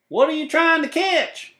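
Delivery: high loud twangy character voice; high cowboy voice; cowboy Leroy. Acted. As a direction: call out like an eager cowboy.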